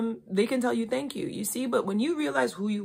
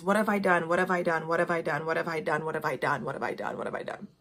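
fading panicked repetition. Retold or imitated. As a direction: let the repeated question die away to a whisper. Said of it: "the thing he kept saying is".

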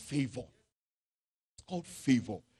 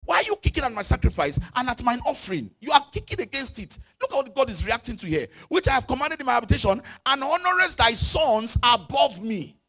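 rising, louder angry voice of God; 6 semitones higher, 6 dB louder than usual. Acted. Preacher voicing an angry God at the congregation. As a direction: thunder God's rebuke. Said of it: "This is God angry".

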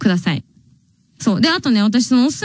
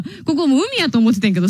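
usual speaking voice

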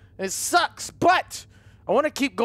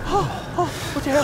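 ordinary talk

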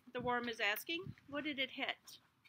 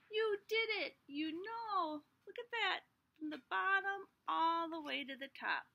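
higher, excited praise; proud praise; 6 semitones higher than usual. Acted. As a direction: celebrate the seed. Praise for the seed.

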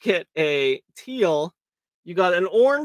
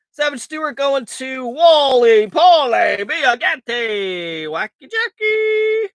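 excited call; 7 semitones higher, 9 dB louder, slower than usual. Real, not acted. Announcing slot hits excitedly.

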